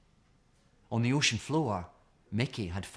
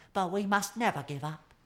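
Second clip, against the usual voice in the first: higher, determined little voice; a penguin refusing to quit; Penguin resolute; 9 semitones higher than usual. A narrator's reading. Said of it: "said Penguin".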